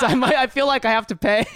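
real laugh mid-sentence. Real, not acted. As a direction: giggle it out. Laughing at own cheapness.

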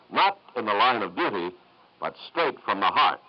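ordinary talk, nothing marked

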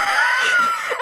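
a high, loud burst of laughter; real shrieking cackle at own joke. Real, not acted. Podcaster cracks up at her own polycule joke, loud high shrieking laugh.

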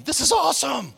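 high, giddy thrill-seeker; Murdoch's glee. Retold or imitated. Crazy pilot about to crash, high giddy glee. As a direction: relish the crash like a wild pilot. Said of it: "Or you could be Murdoch going".

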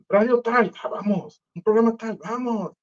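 high, eager invitation to the show; mock excited radio announcer; eager radio host. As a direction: pitch it up like a pushy radio host. Radio hosts inviting him eagerly.

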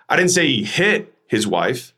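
"hit" lifted and punched, pause after it; stress on HIT. Shown as a demonstration. Coach showing emphasis changes meaning, stress on HIT. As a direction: land hard on "hit", then pause. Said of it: "then the emphasis".

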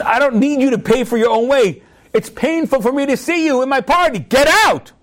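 acted anger rising in pitch to a final order; angry dismissal. Host ordering his enemy out of the party, angry. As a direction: play the host throwing someone out.

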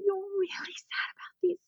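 put-on high, whispered comfort to a child; gentle soothing whisper. Mum kneels beside her crying toddler, soft whisper. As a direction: hush and soothe the little one.

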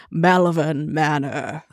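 slow, drawn-out ominous gentleman. Acted. Co-host announcing the haunted place, as a slow ominous gentleman. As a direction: draw the name out like an ominous gentleman. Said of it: "say it like an ominous gentleman".

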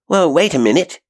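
high, loud call to wait; urgent exclamation. A narrator's reading. Janet seizing her suitcase to follow, exclaiming high and loud. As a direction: exclaim it, pitch and volume leaping up. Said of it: "exclaimed Janet".

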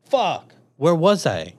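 loud, high, drawn-out swearing; retold alarmed curse. Soldier retelling finding his gun gone, loudly dismayed. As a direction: curse slowly and loudly, reliving the shock.

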